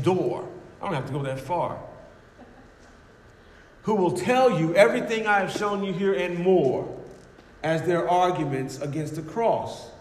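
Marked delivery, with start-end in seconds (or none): none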